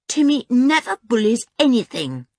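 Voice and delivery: high, loud defence of the dog; indignant retort. A narrator's reading. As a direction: snap back in protest. George retorts at once, frowning.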